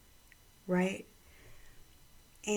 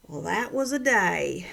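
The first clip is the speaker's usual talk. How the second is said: put-on Southern accent; 7 dB louder than usual. Son quotes Steve's favourite line to mom, loud Southern accent. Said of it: "beautiful little Southern accent".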